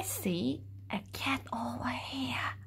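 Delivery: hushed discovery whisper. Acted. Reading to kids, whispering about the cat. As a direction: whisper as if not to scare the cat. Spotting cat in picture book.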